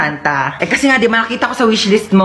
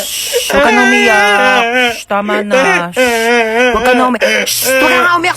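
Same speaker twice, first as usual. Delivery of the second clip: put-on hushing the baby. Mother hushing her crying newborn, growing impatient. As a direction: soothe then scold a crying baby.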